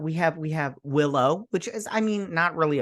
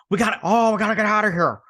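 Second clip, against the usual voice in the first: loud, higher-pitched mock urgency; retold urgent cry; 7 semitones higher, 5 dB louder, faster than usual. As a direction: act out a hurried call to flee. Retold urgent escape line.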